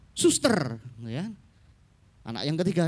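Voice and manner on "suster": "suster" ending in a long trilled R; rolled final R. Acted. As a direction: roll out the R at the end of "suster". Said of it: "Semuanya err semua". ("suster" dengan R akhir bergetar panjang)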